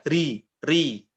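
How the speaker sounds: ri with an Indonesian r. Shown as a demonstration. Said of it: "Atau seperti R-nya kita".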